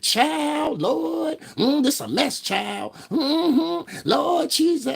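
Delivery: very high church-lady exclamations; hammed-up shocked auntie; high mock gasps of Lord Jesus; mocking sassy voice. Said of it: "talk like that".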